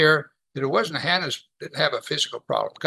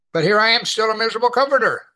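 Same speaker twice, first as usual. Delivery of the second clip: self-mocking; 7 semitones higher, 5 dB louder, faster than usual. Real, not acted. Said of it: "self-mocking confession".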